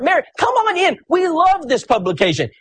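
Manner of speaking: very high, delighted call; thrilled, high-pitched invitation; joyful welcome. Retold or imitated. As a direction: welcome them in with glee. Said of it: "He was so excited".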